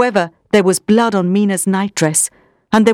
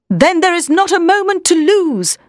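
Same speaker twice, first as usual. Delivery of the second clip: urgent cry; 9 semitones higher than usual. Acted. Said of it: "the professor cried".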